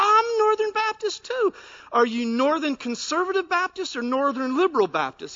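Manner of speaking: high, loud delighted cry; overjoyed. Retold or imitated. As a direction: squeal with delight at the match. Overjoyed man in joke.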